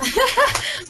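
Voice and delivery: short, high burst of laughter; amused laugh. Real, not acted. Presenter amused by Michael Hernandez's offer to help, laughing. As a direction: break into a quick real laugh.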